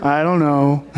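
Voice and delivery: put-on low, small admission as a kid; mumbly imitation of a guilty child; sheepish child. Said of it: "whenever you ask a child".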